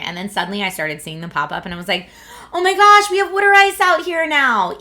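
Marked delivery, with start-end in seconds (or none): 2.5-4.8 high, loud gushing excitement; re-enacted thrilled discovery; excited outburst. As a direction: exclaim it in high-pitched, loud amazement. Retold delight at seeing water ice.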